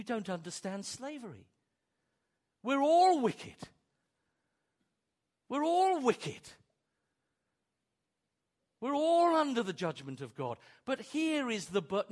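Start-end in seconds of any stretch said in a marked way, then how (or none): 2.6-9.6 loud, high proclamation; preaching shout. Real, not acted. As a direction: shout it out to the congregation. Preacher hammering his point.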